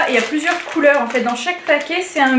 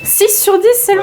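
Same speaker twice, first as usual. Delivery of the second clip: surprised; 7 semitones higher, 4 dB louder than usual. Real, not acted. Reacts to low score.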